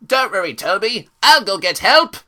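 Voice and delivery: high-pitched eager helper voice; hammed-up high cartoon voice; voiced cartoon character. Acts out episode dialogue.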